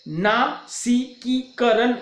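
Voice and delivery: नासिकीकरण drawn out slowly, each syllable weighted; stressed syllables. Shown as a demonstration. Teacher isolating the sounds that take force, stressed. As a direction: break नासिकीकरण into slow, stressed pieces. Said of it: "थोड़ा सा बल देकर उच्चारित करते".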